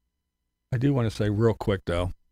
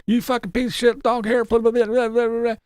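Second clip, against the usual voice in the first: much higher, sneering mock insults; mocking troll voice; 10 semitones higher than usual. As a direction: squeak out the insults in a high mocking voice. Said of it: "Then it was a little sock account".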